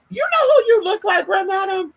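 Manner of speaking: sudden high squeal of recognition; bursting with excitement at the lookalike; excited realisation. Real, not acted. Sudden realisation addressed to viewer.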